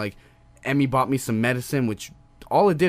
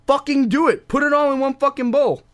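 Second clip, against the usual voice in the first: worked-up rant; 11 semitones higher, 5 dB louder than usual. Real, not acted. Worked-up rant about toppings.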